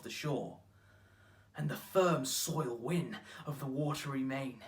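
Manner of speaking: slow, loud declaimed sonnet; poetry recital. Acted. Actor reciting a sonnet on time's ruin, declaimed. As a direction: declaim it in measured, weighty phrases.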